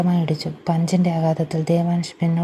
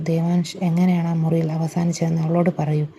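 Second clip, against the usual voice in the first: ordinary delivery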